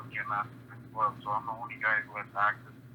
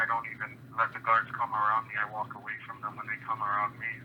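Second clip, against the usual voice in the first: usual speaking voice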